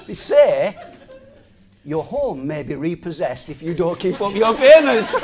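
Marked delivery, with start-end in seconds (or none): 1.9-5.2 can't keep a straight face reading the warning; laughing quote. Retold or imitated. Quoting the mortgage advert to viewers, laughing. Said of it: "We shouldn't laugh".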